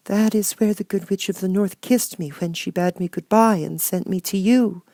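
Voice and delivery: put-on a meek little girl finding courage; little Dorothy. Dorothy replies.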